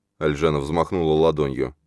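ordinary delivery, nothing marked

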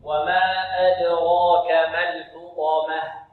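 slow, chanted "وما أدراك ما الحطمة"; Quranic recitation. Shown as a demonstration. Teacher modelling the verse for students, slow careful recitation. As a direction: recite "الحطمة" with heavy ta and light mim. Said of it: "نوقف الحاء ونفخم الطاء ونرقق".

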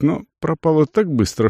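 plain reading voice

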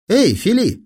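high grinning call; grinning boy. A narrator's reading. Sherman hails his tennis partner, grinning. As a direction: call out high through a grin. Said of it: "растянулся в улыбке Шерман".

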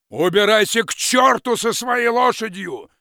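high, loud angry yell; shouting after him. Acted. Forester yelling after the departing peasant, angry shout. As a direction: drive him out the door. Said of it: "закричал он ему вслед".

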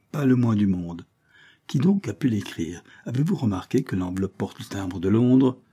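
usual speaking voice

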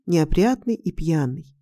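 ordinary delivery

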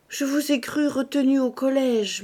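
usual speaking voice

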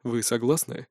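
plain reading voice

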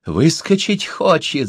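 high, drawn-out call of alarm; crowd's cry. A narrator's reading. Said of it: "закричали в толпе".

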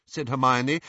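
usual speaking voice

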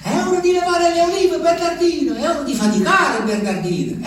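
comando alto e cantilenato; ordine degli ulivi. Pretend. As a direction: gli ulivi ordinano di lavorare, tono alto. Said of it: "Mi ordinano". (gli alberi gli ordinano di raccogliere, voce alta che poi cala)

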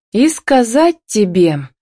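drawn-out confession about to come; breathed out. A narrator's reading. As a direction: let it out with effort. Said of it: "выдохнула она".

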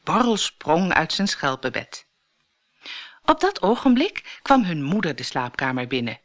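plain reading voice

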